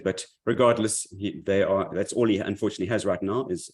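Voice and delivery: ordinary talk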